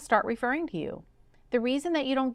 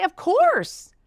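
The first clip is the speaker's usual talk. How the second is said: eager agreement; 9 semitones higher, 8 dB louder than usual. Retold or imitated. Patient eagerly agreeing to record.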